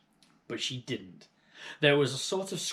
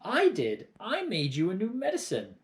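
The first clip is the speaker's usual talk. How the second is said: put-on insistent boy; 8 semitones higher, 3 dB louder than usual. George insists.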